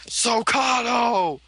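high, drawn-out mock battle scream. Acting out the villain who started screaming, drawn-out mock scream. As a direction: imitate a villain screaming, stretching the word out slowly. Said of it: "Then he started screaming".

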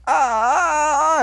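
high, drawn-out fake scream; mock scream. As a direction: scream as if blasted backwards, hammed up. Imitates heroes being blasted.

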